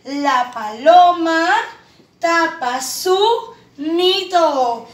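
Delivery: "La paloma tapa su nido" drawn out as one phrase; whole sentence slow and clear; slow smooth reading. Shown as a demonstration. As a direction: read it slowly and joined. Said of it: "Muy bien".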